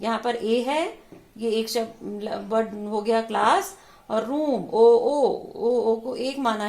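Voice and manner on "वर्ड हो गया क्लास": ordinary talk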